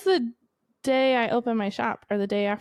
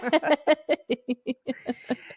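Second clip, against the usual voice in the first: happy laugh; 3 semitones higher than usual. Real, not acted. Friend laughs after news.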